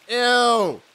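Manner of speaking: loud, high ew; disgusted. Real, not acted. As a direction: recoil in disgust. Landing in bodies.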